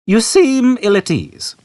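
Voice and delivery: put-on high, slow old man with polite concern. A narrator's reading. Old man to Arthur, high, slow and politely concerned. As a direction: gently ask if he is uneasy. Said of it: "with polite concern".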